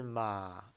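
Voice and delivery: 马 sinking low, drawn out; third tone. Shown as a demonstration. Teacher modeling the third tone, 马 long and low. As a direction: let 马 drop slowly to a low pitch. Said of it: "三声是马".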